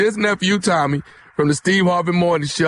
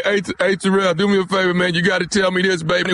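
ordinary delivery

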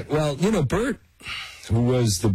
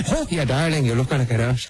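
put-on Irish accent; 3 semitones higher, faster than usual. Actor mimicking his co-star's first take, mock Irish accent. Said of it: "He's gonna do an Irish accent".

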